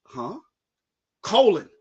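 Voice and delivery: ordinary talk, nothing marked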